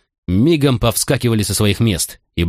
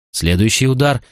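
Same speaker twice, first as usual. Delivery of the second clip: ordinary delivery, nothing marked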